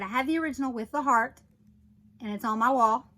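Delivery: ordinary talk, nothing marked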